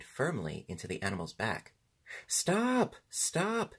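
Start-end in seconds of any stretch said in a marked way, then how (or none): 2.3-3.7 high, ringing cry of protest; high alarmed shouts from a horse; shouted protest. A narrator's reading. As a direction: shout for it to stop, pitch leaping up. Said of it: "shouted the horse".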